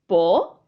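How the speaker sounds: po-e-ta con una pausa tras cada sílaba; separado en sílabas. Shown as a demonstration. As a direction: di po, e, ta como tres sílabas sueltas. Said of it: "Sílabas diferentes". (po-e-ta silabeado, vocales separadas)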